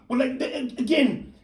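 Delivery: ordinary talk, nothing marked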